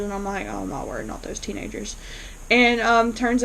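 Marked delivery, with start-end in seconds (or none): 0.5-2.0 dismayed aside. Retold or imitated. Viewer reacting to those teenagers, quietly dismayed. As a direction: drop the volume for a weary aside.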